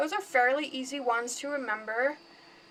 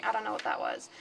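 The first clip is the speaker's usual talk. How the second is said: ordinary talk, nothing marked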